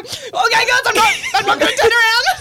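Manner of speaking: high, loud laughing shout; laughing mock skipper. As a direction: voice the skipper through giggles. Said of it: "our skipper's going".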